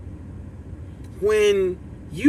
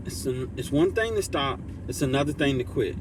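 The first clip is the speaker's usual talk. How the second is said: "It's one thing to stop. It's another thing to quit." spaced out, with "thing" and "another" leaned on; emphatic message; 6 semitones lower, 6 dB quieter, faster than usual. Shown as a demonstration. Streamer driving home his motto to chat, quiet and weighty. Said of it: "this is my message".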